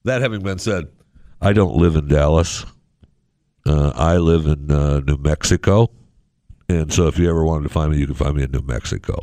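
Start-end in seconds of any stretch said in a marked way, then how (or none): 1.4-9.2 louder, lower deadpan claim; mock alibi. Host jokingly hides where he lives from fans, loud deadpan. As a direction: fake an alibi with a straight face. Jokingly denying his location.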